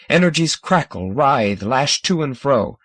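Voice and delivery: plain reading voice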